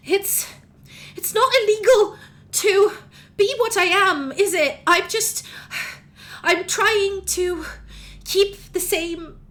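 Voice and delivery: put-on high, loud, anxious young voice, slowing near the end; a frightened child pleading to be accepted; pleading changeling child. Boy pleads with the court for acceptance, high, anxious and loud.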